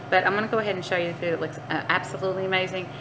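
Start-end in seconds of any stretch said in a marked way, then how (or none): none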